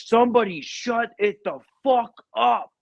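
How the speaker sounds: retold yell, high-pitched and exasperated; irritated yell. Captain woken up yells out his door, irritated yell.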